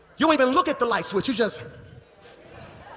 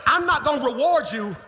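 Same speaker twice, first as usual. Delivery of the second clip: high, driving sermon shout; impassioned preacher building in punched phrases; full-force preaching; 5 semitones higher than usual. Real, not acted. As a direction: drive each phrase home to the congregation.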